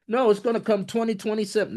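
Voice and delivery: high, fast mock of a doubter; mocking skeptic. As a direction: rattle it off as a silly naysayer. Mocking someone's doubting reply.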